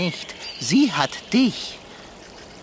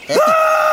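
startled yelp; 8 dB louder than usual. Acted. Cat startled back.

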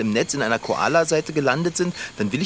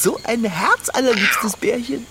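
put-on high, slow, gushing baby talk; gushing coo; 8 semitones higher, slower than usual. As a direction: swoon over the little bear. Gushing over koala. (schwärmerisch gurrend)

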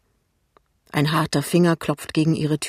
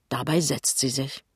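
usual speaking voice

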